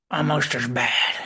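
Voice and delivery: slow Southern grumble; grudging old Southerner, drawn out; Southern McCoy. Acted. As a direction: grouse slowly in a Southern accent. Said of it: "picked up some kind of Southern accent".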